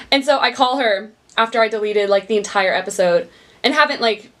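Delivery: usual speaking voice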